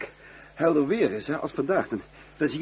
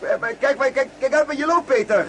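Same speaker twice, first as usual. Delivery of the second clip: higher, hurried repeated warning; urgent warning; 9 semitones higher, 3 dB louder than usual. Real, not acted. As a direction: call out so he watches his step. Wasps swarm around them.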